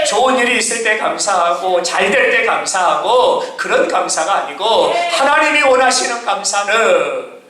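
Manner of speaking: rhythmic, chant-like sermon refrain; climactic preaching. Real, not acted. Preacher sums up true thanks for congregation, climactic preaching. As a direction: drive home each phrase, stretching the key words.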